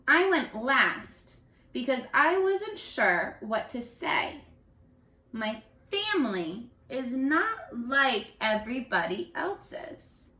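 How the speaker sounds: storybook reading, evenly paced; teacher reading a picture book aloud; reading to children. Acted. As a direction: read it aloud to the class like a storyteller.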